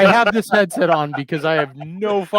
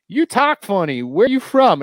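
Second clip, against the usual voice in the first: higher-pitched mock curious stranger; retold bar stranger; 7 semitones higher than usual. Stranger in a bar questioning an outsider, higher imitation. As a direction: imitate a nosy barfly, pitched up. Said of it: "somebody'd be like".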